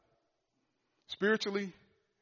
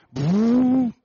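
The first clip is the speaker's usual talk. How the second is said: loud higher burst for the engine catching; imitated engine boom; 8 semitones higher, 11 dB louder than usual. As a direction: bark out the engine roaring back. Tractor firing back up.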